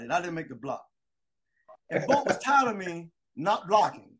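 ordinary delivery

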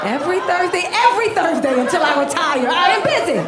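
high-pitched mock outrage, building to a shout; outraged refusal. Acted outraged refusal of suitor.

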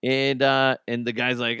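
ordinary talk

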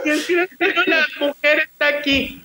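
high, drawn-out tattling with laughter; retold urgent report. Son tells mum the woman is here, urgent.

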